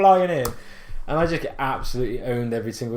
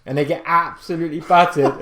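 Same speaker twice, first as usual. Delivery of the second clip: ordinary talk, nothing marked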